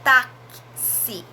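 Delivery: "táxi" with the X as a clear ks; careful demo. Shown as a demonstration. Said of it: "Presta atenção quando eu falo a palavra". ("táxi" dito com X claro como ks)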